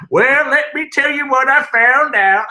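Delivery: loud, high Southern drawl; Southern accent. Retold or imitated. Mocking her family's Southern talk, loud Southern accent. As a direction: stretch it out in a big mock Southern accent. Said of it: "want to bash the Southern accent".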